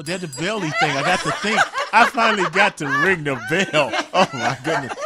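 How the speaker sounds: bursting out laughing with joy. Real, not acted. Finally rang the bell, laughing.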